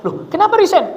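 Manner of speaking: sudden high surprised "Loh, kenapa resign?"; a boss caught off guard, pitched high; mock surprise. Boss asking the new hire why, mock surprised.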